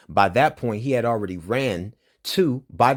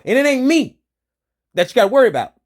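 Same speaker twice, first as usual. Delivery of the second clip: much higher, much louder furious shout; 9 dB louder, faster than usual. Real, not acted. Threatening the police, a furious high shout. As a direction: yell the threat at full force.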